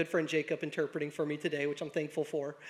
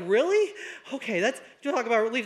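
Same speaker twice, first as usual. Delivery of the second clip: relieved surprise; 4 semitones higher, 4 dB louder than usual. Retold or imitated. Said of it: "we're like".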